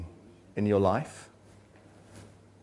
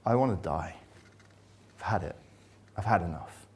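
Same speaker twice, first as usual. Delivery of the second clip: despairing; 3 semitones lower, 5 dB quieter, faster than usual. Retold or imitated. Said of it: "And now".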